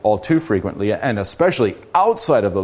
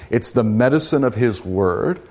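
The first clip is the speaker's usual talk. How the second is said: ordinary talk, nothing marked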